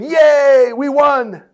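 high, loud mock victory whoop; hammed-up gleeful yay; mock cheer. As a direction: cheer like your side just won. Mock cheer of election winners.

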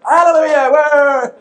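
high, loud mock congregational whoop; cheering Pentecostals. Imitating Pentecostals cheering a hymn line, exuberant mock cheer. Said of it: "all the Pentecostals went".

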